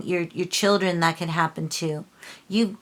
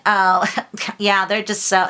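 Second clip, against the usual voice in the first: louder, scoffing imitation; mock judgmental gossip; 3 semitones higher, 7 dB louder than usual. Reader imitates people judging others, scornful. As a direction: scoff like someone running others down.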